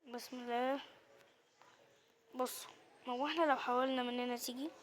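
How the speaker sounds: ordinary talk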